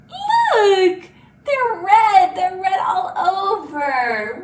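high, loud and drawn-out wonder; amazed reveal. Pretend. Teacher shows children the red kitty, playfully amazed. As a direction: squeal the discovery in pretend shock.

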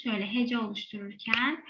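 ordinary talk

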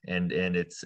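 normal talking voice

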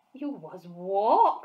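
loud, slow, stunned disbelief; shocked cry. A narrator's reading. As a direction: stretch out the shock. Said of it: "cried the B F G".

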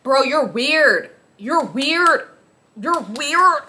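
slow, teasing repeated jab; imitating the comment section, mocking; mock commenters. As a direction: drag out the weird. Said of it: "people would just comment".

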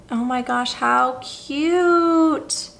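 normal talking voice

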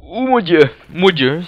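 high, loud "oh my God"; shocked exclamation. Pretend. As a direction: cry out in sudden shock. Shock at event in game.

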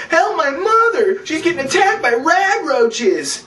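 high, slow, drawn-out cry for help; mock panicked plea. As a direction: wail for help as the bully. Butch begs for help.

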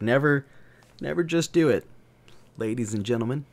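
ordinary talk

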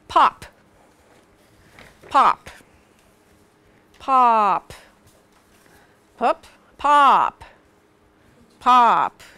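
pop, popped out loudly, one at a time; pop drill. Shown as a demonstration. Teacher saying test words for card game. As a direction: pop each pop out. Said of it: "so if I said".

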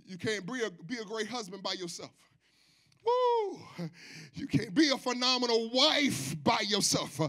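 usual speaking voice

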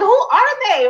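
high, amazed disbelief; incredulous. Retold or imitated. Neighbor spotting a Bentley nearby, high incredulous amazement. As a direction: gawk at a passing luxury car in disbelief. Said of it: "I'm just like".

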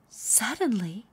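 plain reading voice

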